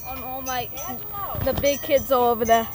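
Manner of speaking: a kid's mock Australian accent, loud on the big kids; hammed-up Australian accent; put-on Australian accent. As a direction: play a scared Aussie bloke. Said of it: "He has this Australian voice".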